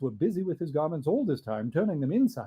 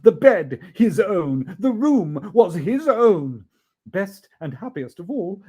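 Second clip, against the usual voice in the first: usual speaking voice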